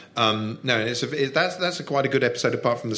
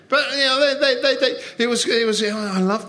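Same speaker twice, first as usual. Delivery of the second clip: excited; 10 semitones higher than usual. Real, not acted. Excited about favourite show.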